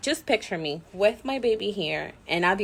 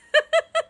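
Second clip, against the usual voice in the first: high giggle. Real, not acted. Laugh after greeting.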